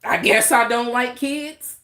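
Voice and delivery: defiant slow sarcasm; sarcastic retort. Real, not acted. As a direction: say it loud and slow, daring them. Sarcastic reply to comment.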